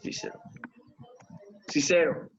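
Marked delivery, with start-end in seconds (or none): 0.0-0.4 barely voiced. Shown as a demonstration. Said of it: "¿Cómo sonaría?".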